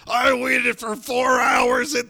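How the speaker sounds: hammed-up outrage at being stood up; mock indignant shout. A Chicagoan mocking someone stood up, in a high hammed-up shout. Mock complaint about missing friend.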